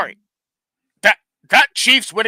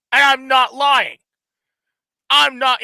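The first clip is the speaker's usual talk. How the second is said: shouting; 4 semitones higher, 4 dB louder than usual. Real, not acted. Heated football argument, insisting.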